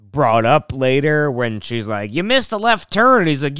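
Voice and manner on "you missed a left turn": high-pitched imitated complaint; mock nagging. Mom criticizing dad's driving, mock nagging. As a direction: pitch it up like a nitpicking spouse. Acted spouses bickering later.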